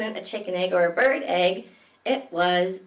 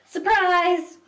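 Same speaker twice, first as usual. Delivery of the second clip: high bright exclaim; reading to children, excited surprise; 8 semitones higher, 5 dB louder than usual. Acted. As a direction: announce the reveal with delight. Crocodile pops out.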